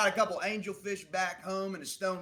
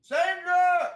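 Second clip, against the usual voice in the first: much higher, louder yell of a name; calling out; 12 dB louder than usual. Acted. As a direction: call her from across the shop. Clerk calls out to manager.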